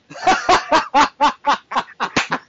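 long high cackle of laughter; burst of laughter. Real, not acted. Co-hosts crack up over a guest's put-down, high laughter.

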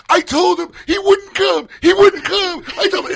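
high, loud, drawn-out protest; mock distraught wail. Host imitating woman caught in prank, distraught wail. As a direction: plead innocence in an exaggerated high cry.

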